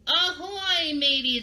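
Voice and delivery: high, hearty pirate hail; piratey greeting. Shown as a demonstration. Teacher showing children how to greet pirate friends, piratey. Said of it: "we need to sound piratey".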